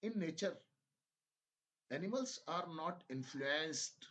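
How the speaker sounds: very slow, emphatic reading in separated phrases; dictation-style reading. Shown as a demonstration. Teacher dictating a health passage to English learners, slow emphatic reading. Reads passage for listening practice.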